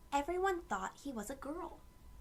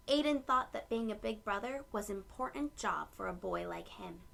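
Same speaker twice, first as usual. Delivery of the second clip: usual speaking voice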